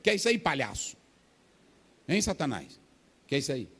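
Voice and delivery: with authority. Shown as a demonstration. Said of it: "Pode falar assim, meu irmão, com autoridade".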